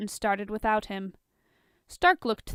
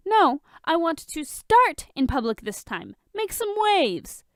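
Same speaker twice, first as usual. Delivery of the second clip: high, loud eager push; Tony excitedly pushing Bruce to go public; excited pitch; 8 semitones higher, 6 dB louder than usual. Acted. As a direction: sell it with rising glee.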